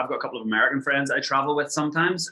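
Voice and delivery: ordinary delivery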